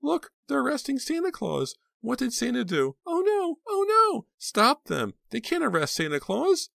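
put-on high, loud upset child's cries; alarmed child. A narrator's reading. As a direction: protest like a shocked kid.

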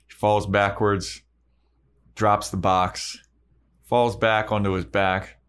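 ordinary talk

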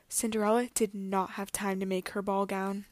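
ordinary delivery

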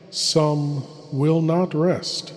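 usual speaking voice

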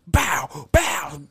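imitated impacts, sharp and separate; mock kick noises. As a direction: land each one like a blow. Acting out kicks to the face.

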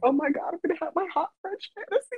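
high, rushed giddy squeal; thrilled reaction. Retold or imitated. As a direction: gush in a rising, excited rush. Acts out her thrilled reaction to date.

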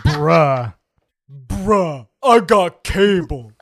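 mocking imitation of a co-host; exaggerated, slow mimicry of a friend; Keisha voice. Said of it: "That's my new Keisha voice".

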